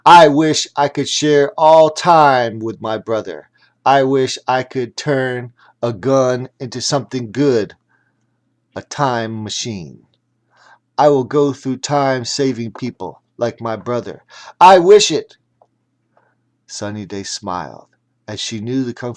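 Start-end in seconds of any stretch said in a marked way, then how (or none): 0.0-14.2 loud, ringing wish; earnest shouted wish; calling out loud. A narrator's reading. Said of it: "called out as loud as he could". 14.6-15.4 high, loud final cry; full shout. A narrator's reading. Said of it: "called out as loud as he could".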